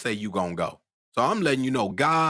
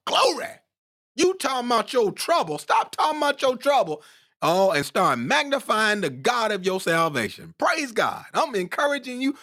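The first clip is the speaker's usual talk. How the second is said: high-pitched, impassioned exhortation; fired-up preaching; 11 semitones higher than usual. Real, not acted. Preacher urging congregation to magnify God, fired-up preaching. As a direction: preach at full force, voice pitched up.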